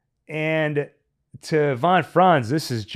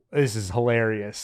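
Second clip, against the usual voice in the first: ordinary delivery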